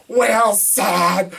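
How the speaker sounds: a high, loud anguished cry of the volleyball's name; desperate Castaway yell. Retold or imitated. Reviewer quoting Castaway, crying out for the volleyball in anguish. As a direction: shout for the lost friend across the water. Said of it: "Coming number seven is Castaway".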